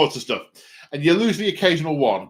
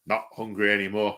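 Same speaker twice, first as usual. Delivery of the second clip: ordinary delivery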